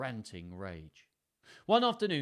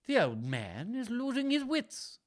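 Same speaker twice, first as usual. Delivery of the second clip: high, drawn-out character voice for a scheming daughter; Regan's murmur, voiced up; 12 semitones higher, 5 dB louder than usual. A narrator's reading. Reader voices Regan confiding about her father, high stagey murmur. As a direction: voice Regan high, loud and slow. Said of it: "murmured Regan".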